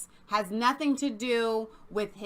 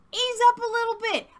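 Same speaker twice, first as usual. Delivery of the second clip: high, put-on Chinese accent; hammed-up Chinese accent for laughs; jokey Chinese accent, much higher than own voice; mock Chinese accent; 12 semitones higher, 4 dB louder than usual. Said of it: "doing the Chinese accent".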